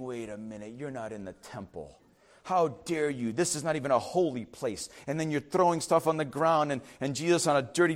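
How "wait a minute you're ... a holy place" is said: a low, much softer scandalized mutter; disapproving Pharisees. Retold or imitated. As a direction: hush it like a shocked onlooker. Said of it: "looking at everybody and saying".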